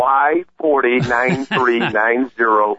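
slow, deliberate, digit by digit; careful correction. Shown as a demonstration. Caller correcting the host's wrong plate number, slow and deliberate. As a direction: spell the plate out so the host gets it right. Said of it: "No, get it right".

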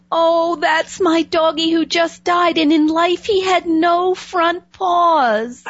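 retelling a grieving girl's sobbing words, half-acted; imitating tearful girl. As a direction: play the crying girl, slowed and lifted high. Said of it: "bursts into tears crying".

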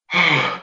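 imitated big gasp; gasp for air. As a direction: act out coming up for air. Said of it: "I get air".

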